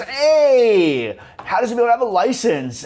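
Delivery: high and loud cheerful call; bright high greeting. Retold or imitated. Cher greets Ty and Josh, high loud chirpy. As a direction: call out sweetly to the boys. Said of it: "she goes".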